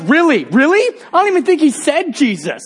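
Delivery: retold comic bewilderment; incredulous. Leader still disbelieving her conversion.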